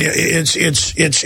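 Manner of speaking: ordinary delivery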